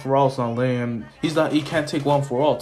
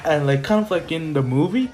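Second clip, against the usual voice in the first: ordinary talk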